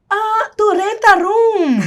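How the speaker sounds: high, loud, slow mock Maltese English; Maltese accent. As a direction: ham up a thick Maltese accent. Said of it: "todo mundo falava". (imitando sotaque maltês, bem alto e lento)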